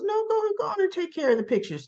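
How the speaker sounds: high, quick retold urging; shooing away. Man wants to talk alone, mock shooing. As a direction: hurry her off in a rush.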